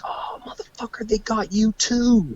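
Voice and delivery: imitating a buddy's drawn-out gloating; gleeful mock dismay. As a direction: draw it out high, teasing a buddy caught too. Buddy spots him, pointing and laughing.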